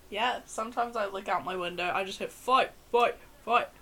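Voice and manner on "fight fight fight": mock crowd chant, louder; retold shouting. Hearing a street fight outside the window, retold shouts. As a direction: call it out like onlookers egging on a brawl.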